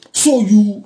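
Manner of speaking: ordinary delivery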